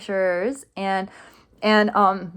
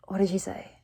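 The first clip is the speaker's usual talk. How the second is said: muttering to herself under breath; whispered aside; 6 dB quieter than usual. Real, not acted. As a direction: search your memory in a whisper.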